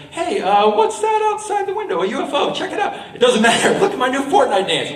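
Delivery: high-pitched, animated mock excitement; goofy look-at-that diversion; playful distraction. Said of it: "by kind of distracting the bully".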